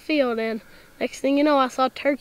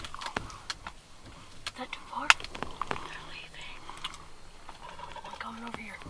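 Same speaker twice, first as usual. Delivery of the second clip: hunting whisper; 3 semitones lower, 15 dB quieter than usual. Real, not acted. Son whispering to his dad about the birds. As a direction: breathe the words out. Hunters whispering in turkey blind.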